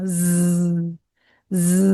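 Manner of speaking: ز held as a low, soft buzz. Shown as a demonstration. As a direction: let the ز buzz. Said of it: "یہ والا sound". (ز buzzing آواز کے ساتھ)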